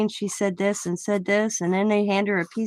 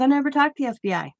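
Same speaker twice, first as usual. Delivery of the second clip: higher, fast protest imitating a witness; mock indignant denial, higher and quick; 5 semitones higher, faster than usual. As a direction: rattle it off high like the indignant witness. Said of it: "And she goes".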